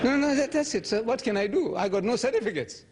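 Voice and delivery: high-pitched, laughing plea; comic high-voiced helplessness; helpless protest. Acts helpless reply to being disqualified.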